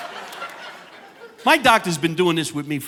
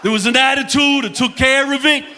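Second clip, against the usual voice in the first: comedian as captain; 8 semitones higher than usual. Pretend. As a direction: announce it like a tipsy captain on the PA. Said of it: "if I came over the loudspeaker".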